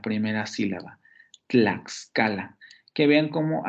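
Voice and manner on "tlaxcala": Tlaxcala drawn out, tl and ks clusters clear; slow careful Tlaxcala. Shown as a demonstration. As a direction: spell out Tlaxcala slowly. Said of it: "la palabra Tlaxcala tiene dos consonantes".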